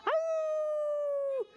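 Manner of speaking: high yip of a howling coyote; a children's teacher's high coyote howl. Shown as a demonstration.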